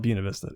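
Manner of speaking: Buena Vista said as BYOO-nuh VIS-tuh; "Buena Vista" with Buena starting like beauty; local pronunciation. Shown as a demonstration. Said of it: "they call it Buena Vista".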